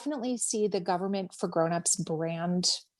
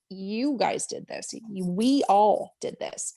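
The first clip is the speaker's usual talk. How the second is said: "You guys did this" then "We all did this", slow and stressed; thanking her audience, words drawn out and stressed; slow emphatic credit; slower than usual. Real, not acted. Slow, stressed. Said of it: "crediting her audience for donations".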